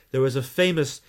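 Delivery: ordinary talk, nothing marked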